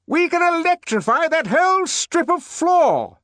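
very high, loud bawl. Acted. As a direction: bawl out an explanation. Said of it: "bawled the director in explanation".

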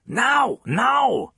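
put-on high, urgent Danish-accented shout. A narrator's reading. Cello teacher driving his student on, shouted. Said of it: "he shouted".

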